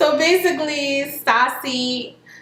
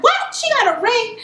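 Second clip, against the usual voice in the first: much higher, outraged disbelief; shocked gasp; 11 semitones higher than usual. Retold or imitated. Stasia hearing her rival got a ring, shocked and much higher. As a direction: jump up in pitch, stunned.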